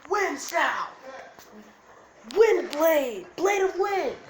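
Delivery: high, loud anime attack shout; battle cry. Acted. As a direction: yell the jutsu name, last words drawn out. Acted anime attack call.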